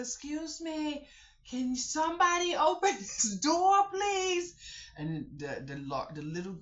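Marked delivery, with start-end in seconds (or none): 0.0-4.5 high, loud call through the door; loud high sing-song call with a laugh; calling through glass. Retold or imitated. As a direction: call out to the kids behind the glass. Knocking on glass, calling kids.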